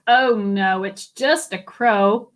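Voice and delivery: ordinary delivery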